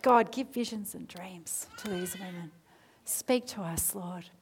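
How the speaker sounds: soft, hushed little prayer; murmured plea to God; model prayer. Shown as a demonstration. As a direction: pray it quietly, trailing almost to nothing. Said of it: "Keep praying".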